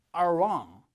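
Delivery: R wrong slow and joined; correct R onset. Shown as a demonstration. Teacher repeating the model for students, slow R gliding into wrong. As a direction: stretch R straight into wrong. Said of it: "한 번 더".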